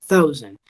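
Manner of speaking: thousand with the th close to a d; thousand, th softened toward d; th like a modified D. Shown as a demonstration. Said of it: "un sonido de la D un, un tanto modificado".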